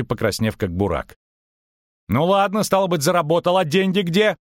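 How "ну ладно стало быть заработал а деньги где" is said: high-pitched, impatient questioning; heated demand. A narrator's reading. Merchant demands the money from his double, impatient. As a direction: press hard in a raised voice.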